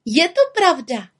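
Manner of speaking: high, proud confirmation; proud little-witch exclamation; calling out. A narrator's reading. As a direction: confirm it in a high, piping call. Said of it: "volala malá čarodějnice".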